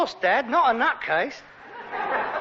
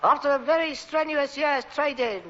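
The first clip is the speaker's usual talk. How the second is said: lightly voiced pompous chairman, a touch higher; a hint of a company chairman addressing shareholders; mock formal report to the shareholders; 5 semitones higher than usual. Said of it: "he said".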